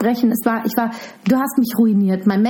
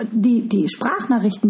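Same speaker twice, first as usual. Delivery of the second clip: usual speaking voice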